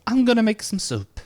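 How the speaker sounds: put-on high, sweet old lady; high Muriel voice. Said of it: "It's like".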